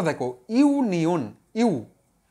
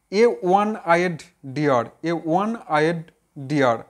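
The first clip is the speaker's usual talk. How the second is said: very slow, word by word; slow dictation; much slower than usual. Shown as a demonstration. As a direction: spell it out slowly for the class. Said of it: "ঠিক একই রকম দেখো". (খুব ধীরে, শব্দে শব্দে বলা)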